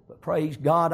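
ordinary talk

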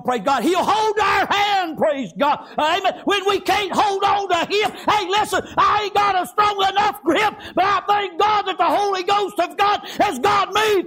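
high, shouted revival cadence; fired-up preacher in full cry; full-force preaching. Real, not acted. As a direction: shout it out in driving, rhythmic phrases.

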